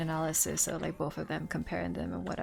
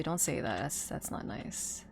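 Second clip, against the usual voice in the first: hushed, slow mock reproach; low and slow mock scold; whispered mock reproach; soft mock scold; 5 semitones lower, 6 dB quieter than usual. Real, not acted. Scolding friend jokingly.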